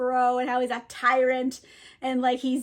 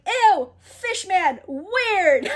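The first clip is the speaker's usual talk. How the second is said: higher, louder drawn-out mock disgust; grossed-out; 6 semitones higher, 6 dB louder, much slower than usual. Trinculo spots a strange fish man, hammed-up disgust. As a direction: recoil with a stretched, mocking "ew".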